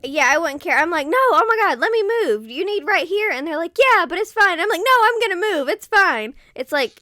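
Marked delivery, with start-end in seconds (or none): 1.1-6.3 much higher and louder, gushing; overly nice insisting. Retold or imitated. Arguing politely with a shopper over the cart, high gushing insistence. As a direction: gush apologies, refusing to be outdone.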